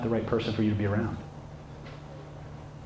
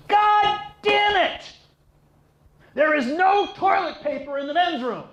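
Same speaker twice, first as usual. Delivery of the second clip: outraged yell; 4 dB louder than usual. Acted. Enraged bodybuilder storming out of the men's room, outraged yell. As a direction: bellow it at top pitch like a raging gym brute.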